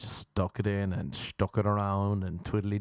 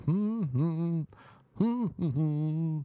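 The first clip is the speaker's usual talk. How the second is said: higher wordless hummed phrase; happy humming; 6 semitones higher than usual. Acted. Showing the girl he's happy, higher humming. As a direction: hum a little tune to show happiness. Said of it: "I'm humming when I'm happy".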